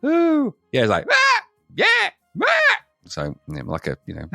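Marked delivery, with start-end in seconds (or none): 0.0-0.5 very high "woo". Retold or imitated. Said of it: "he's not, like, in that". 1.1-2.6 very high, loud imitated yelps; trodden-on chicken squawk. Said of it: "somebody stepping on the, on the back of a chicken".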